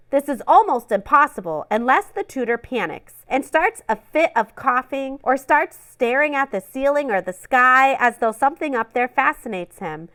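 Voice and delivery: ordinary delivery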